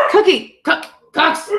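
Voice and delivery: high pet call; calling the dog. Real, not acted.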